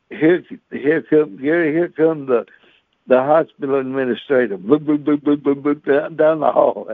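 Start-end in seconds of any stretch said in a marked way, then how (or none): none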